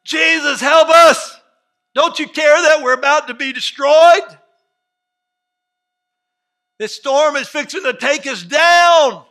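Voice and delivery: high, full-volume panicked scream; yelling and screaming. Acted. Disciples to sleeping Jesus as the storm hits, screaming. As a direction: scream for help over a storm. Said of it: "they start yelling and screaming".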